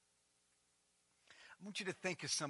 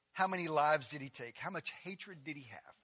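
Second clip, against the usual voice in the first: ordinary delivery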